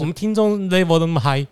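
mixing English words with put-on foreign lilt; show-off returnee code-switching; Westernized accent. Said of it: "讲话带着一些洋腔洋调的单字".

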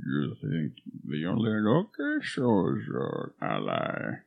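low, soft menacing growl; Bane voice. Retold or imitated. Bane quote parody.

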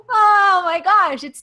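high, slow, tearful exclamation; moved to tears mid-sentence; choked up. Real, not acted. Speaker overcome on the podcast, slow and tearful. Overcome while talking about gratitude.

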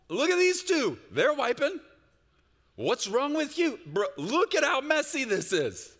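high, loud hammed-up outrage; mock exasperated scolding. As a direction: berate a small kid in mock disbelief. Acted scolding of the little boy.

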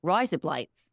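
deliberate Australian vowels; Australian accent. Shown as a demonstration. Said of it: "the only Australian I, can do".

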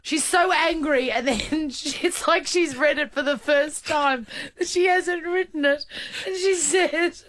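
high-pitched talk through giggles; real giggly storytelling; laughing. Real, not acted. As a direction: keep talking while cracking up. Retelling while laughing.

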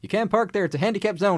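high, loud comic retort; mock scolding shout. Zany character answering furious Baracus, mock scolding shout. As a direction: shout it as a zany movie comeback. Acting out Murdock's comeback.

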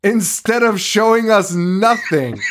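high, incredulous complaint; exasperated rant. Real, not acted. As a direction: vent your disbelief at the show. Ranting about the show's choices.

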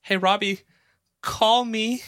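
high-pitched mock call-out; playful high singled-out quote; Diane. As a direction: lightly imitate her, pitch lifted. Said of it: "Diane was like".

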